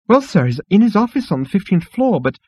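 high, agitated clerk voice; flustered insect receptionist. Acted. Insect receptionist directs Zaphod upstairs, flustered. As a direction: answer in a fluster.

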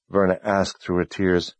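ordinary delivery, nothing marked

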